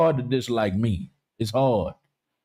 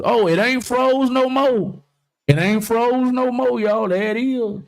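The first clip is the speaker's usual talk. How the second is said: surprised relief; 9 semitones higher than usual. Real, not acted. To May when her camera unfreezes, delighted call. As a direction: greet the unfrozen camera with glee.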